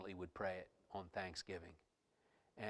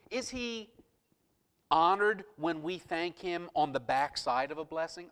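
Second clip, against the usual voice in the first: loud, raised, emphatic sermon delivery; full-force preaching; 6 semitones higher, 11 dB louder than usual. Real, not acted. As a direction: drive each phrase home to the congregation.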